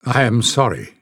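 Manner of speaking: usual speaking voice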